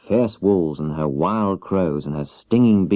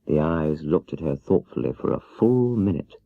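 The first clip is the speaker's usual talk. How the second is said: ordinary delivery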